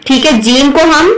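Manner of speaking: ordinary talk, nothing marked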